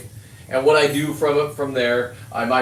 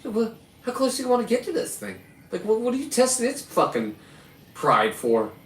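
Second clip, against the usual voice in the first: incredulous high challenge; 9 semitones higher than usual. Real, not acted. Challenging buddies near the bear.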